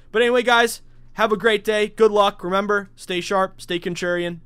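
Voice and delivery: loud, high upbeat farewell; hyped sign-off. Real, not acted. Host signing off to his listeners, hyped and loud. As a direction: pump up the listeners on the way out.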